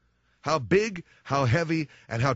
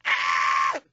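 short loud yelp; mock crash scream; 8 semitones lower, 3 dB louder than usual. Son screaming at the crash, short loud yelp. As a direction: yelp as the truck hits.

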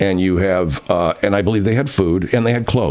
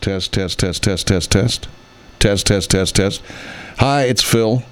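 usual speaking voice

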